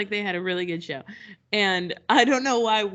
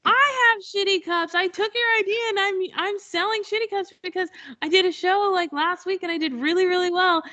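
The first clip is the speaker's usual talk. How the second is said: much higher breathless fangirl confession; gushing fan; 11 semitones higher than usual. Retold or imitated. Fan tells the host she copies her cups, thrilled. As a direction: blurt it all out, thrilled.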